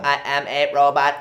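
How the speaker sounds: low one-tone robot; monotone robotic demo. Shown as a demonstration. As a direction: say it on one note like a machine. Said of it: "a little bit more robotic".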